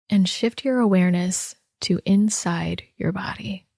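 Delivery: unhurried body-scan guidance with long pauses; guided meditation. Real, not acted. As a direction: guide listeners gently inward, leaving space after each instruction.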